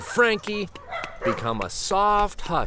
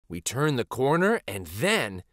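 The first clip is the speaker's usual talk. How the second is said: ordinary delivery, nothing marked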